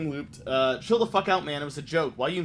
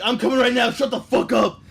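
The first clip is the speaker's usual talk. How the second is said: high, loud hammed-up threat; mock angry troll; 8 semitones higher, 8 dB louder than usual. Retelling a raging troll threatening to come over, mock yell. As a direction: snap it like a furious kid online.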